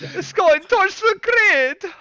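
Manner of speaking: high, slow mock German; hammed-up bad German; terrible German accent. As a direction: stretch it out in a squeaky German accent. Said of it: "That's a terrible German accent".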